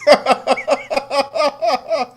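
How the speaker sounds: high, rolling belly laugh; hearty laugh. Real, not acted. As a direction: laugh long at your own prank. Host laughs at his siren prank.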